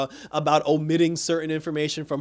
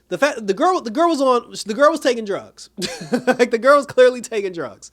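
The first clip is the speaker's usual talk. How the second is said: louder, higher, incredulous emphasis; exasperated rant; 7 semitones higher, 6 dB louder than usual. Real, not acted. Fan ranting that the girl clearly took drugs, loud exasperation. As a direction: hammer the obvious point in disbelief. Venting about show plot.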